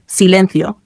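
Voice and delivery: ordinary delivery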